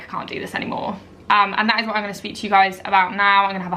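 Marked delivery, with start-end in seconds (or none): 0.0-1.0 whispered despair. Retold or imitated. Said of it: "I was like".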